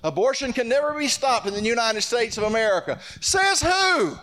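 high, impassioned pulpit declaration; rally the church to resist, punching each phrase; full-force preaching. Acted.

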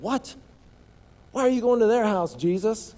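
imitating an indignant crowd, voice jumping high; high, outraged what-are-you-doing; crowd's shocked question. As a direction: townspeople baffled by Jesus's visit. Crowd objecting to Jesus.

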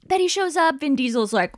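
ordinary talk, nothing marked

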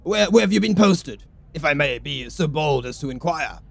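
hearty officer voice. Acted. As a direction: greet him like a fellow officer. Military gent greets commodore.

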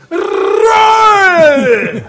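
high, loud drawn-out shout of a name; cartoon director yell. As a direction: call out like a frantic cartoon director. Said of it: "that director in the Daffy Duck".

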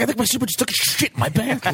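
high, fast, panicky; freaked-out high voice. Retold or imitated. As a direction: blurt it out in a panic. Retelling friend flipping out.

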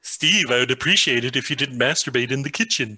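uncanny Stuffy impression. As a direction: sound just like the real guy. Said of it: "sounds too much like the real guy".

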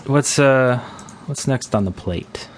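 normal talking voice

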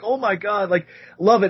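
mock gushing; gushing fan. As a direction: rave like a fan. Fans gushing over him.

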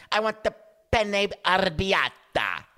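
high, slow, hammed-up Italian accent; bad Italian accent. Actor doing a whole movie like that, fake Italian accent. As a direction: draw it out in a mock Italian accent. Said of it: "Why were we just talking Italian?".

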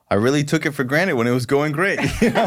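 laughing through the words; light rueful laugh. Real, not acted. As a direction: chuckle while admitting it. Laughing at his own naivety.

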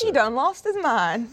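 slow country drawl; slow, disbelieving country accent. Acted. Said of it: "an accent like that".

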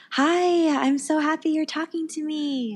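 high, sing-out imitated welcome; delighted greeting. Holy Spirit welcoming her prayer, delighted greeting.

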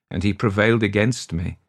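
plain reading voice

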